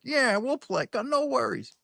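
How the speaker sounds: put-on breezy New Orleans accent, nothing fazes him. Johnny shrugging off the request in a New Orleans accent. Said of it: "He's got one of these New Orleans accents".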